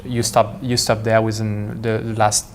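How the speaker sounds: ordinary delivery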